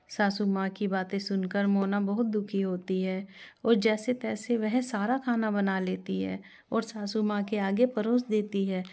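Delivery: usual speaking voice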